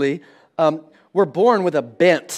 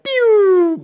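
high mock pew of an arrow flying; playful arrow whoosh; launch sound effect; 13 semitones higher than usual. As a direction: zip the kid away with a sound.

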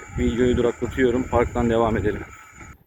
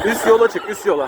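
calling out; 7 semitones higher than usual. Real, not acted. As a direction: shout the direction across. Father calls to son on ATV.

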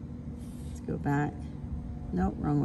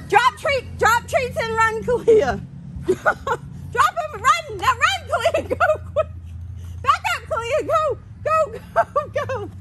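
high, laughing cheers; excited, laughing; 10 semitones higher than usual. Real, not acted. Owner laughing while urging Kalia to run, high. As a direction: urge them on through laughter.

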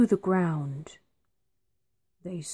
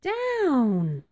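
witch's cry; 5 semitones higher than usual. A narrator's reading. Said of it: "cried the witch".

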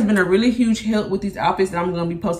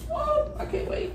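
squealed excitement; 11 dB quieter than usual. Real, not acted. Excited about packages.

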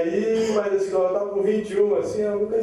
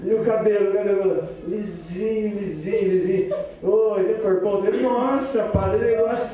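rising eager back-and-forth; excited; 4 semitones higher than usual. Acted. Priest and Pedro getting more and more excited about the girl. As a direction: speed up and lift the voice as it gets juicy.

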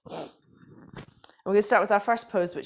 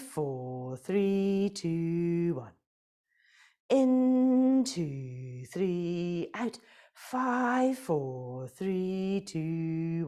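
rhythmic counting cues, up and down; breath counting; much slower than usual. Acted. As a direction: chant the breath counts like an instructor.

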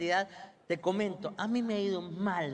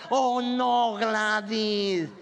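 louder, dragged-out sympathetic moan; skinny friend's reply; 4 dB louder, much slower than usual. Retold or imitated. Friend Gladys answering the complainer, drawn-out "oh no". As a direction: sigh it like a loyal sidekick.

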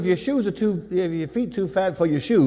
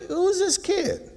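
baffled crowd; 13 semitones higher, 3 dB quieter, much slower than usual. Retold or imitated. The crowd's puzzled look at Jesus.

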